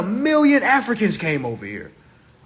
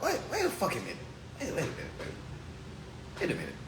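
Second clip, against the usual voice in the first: fading to a hush; 3 semitones lower, 14 dB quieter than usual. Acted. Trailing disbelief to himself.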